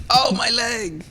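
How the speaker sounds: high, slow, over-the-top cry of pain; mock agony, stretched out; hammed-up wounded peasant. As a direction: play the extra who just got hit, way too big. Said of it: "No, that's too much".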